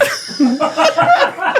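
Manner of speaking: laughing so hard it squeaks; giggling fit remembering the prank; real high laughter. Real, not acted. Recalling the guy's question.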